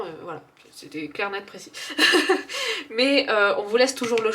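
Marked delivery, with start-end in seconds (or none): 1.7-2.9 high, loud pleased laugh; delighted laugh. Real, not acted. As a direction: laugh with satisfaction. Laughs after clear reading. (rire ravi, aigu et fort)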